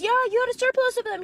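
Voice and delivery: high, loud, quick retold comeback; hammed-up defensive husband; mock defensive insistence. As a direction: insist on a flimsy excuse, pitched right up. Husband acted insisting on excuse.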